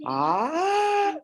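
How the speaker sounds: a sung ah sliding up high. Shown as a demonstration. Teacher to students, showing a high ah. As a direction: glide the vowel up to a high pitch. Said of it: "Ahora hagan un tono así hacia arriba".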